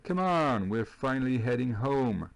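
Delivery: haut et fort, en s'exclamant; réplique enjouée. Acted. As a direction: rejouer avec entrain la réplique du personnage. Rejoue la réplique de Manny. (exclamation enjouée, voix haute et forte)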